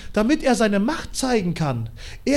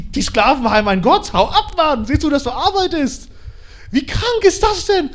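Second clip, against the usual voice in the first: loud, higher scornful king; mocking pharaoh; 5 semitones higher, 5 dB louder than usual. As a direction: scoff at the slave's god. Pharao verhöhnt Mose. (spöttischer Pharao, laut und höher)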